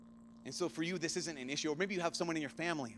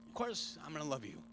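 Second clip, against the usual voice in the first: softer, tender voice; tender reassurance; 2 semitones lower, 6 dB quieter than usual. Real, not acted. Older brother reassuring his little brother, soft and tender. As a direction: reassure him gently.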